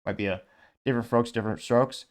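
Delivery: ordinary delivery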